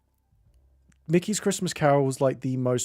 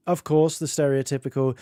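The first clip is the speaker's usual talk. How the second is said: ordinary talk, nothing marked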